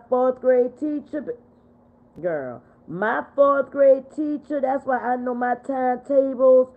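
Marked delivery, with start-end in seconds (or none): none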